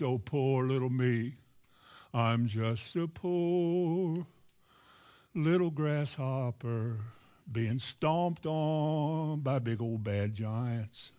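very slow, drawn-out pitiful moan; mock self-pity. Believer looking up at problems, in hammed-up self-pity. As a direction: wallow in it, stretching every word low and slow.

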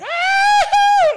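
loud high scream of fright; passenger scream. Retold or imitated. A frightened woman on the plane, loud high scream. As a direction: shriek like a scared passenger in the back. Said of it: "like that in the back".

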